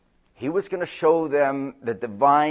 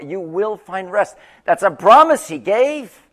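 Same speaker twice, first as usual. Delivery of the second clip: usual speaking voice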